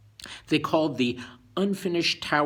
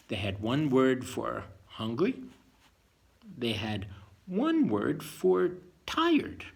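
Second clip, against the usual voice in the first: storybook reading; 2 semitones higher, 3 dB louder than usual. A narrator's reading. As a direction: read it out to a child like a picture book. Reads Bible story aloud.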